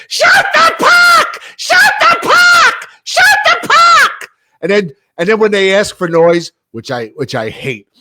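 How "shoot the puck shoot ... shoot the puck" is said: high shrieking mock yell; shrill high-pitched mock scream; shrill screaming fan. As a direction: screech it like an annoying fan. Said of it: "she's got this shrill voice".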